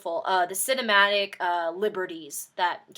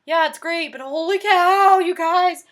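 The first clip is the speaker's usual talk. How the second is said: excited fangirl; 9 semitones higher, 12 dB louder than usual. Retold or imitated. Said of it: "so I was like".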